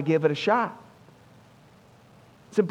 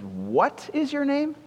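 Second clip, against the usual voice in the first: higher, slow and drawn out, mildly exasperated; frustrated; 7 semitones higher, slower than usual. A narrator's reading. Alexander demanding the soldier's name again, mildly frustrated. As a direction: press the question, a touch fed up.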